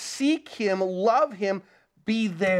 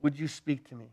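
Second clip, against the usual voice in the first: low, soft morning prayer; a hushed, humble request; 5 semitones lower, 6 dB quieter than usual. Shown as a demonstration. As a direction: pray it quietly, just to God. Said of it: "and say".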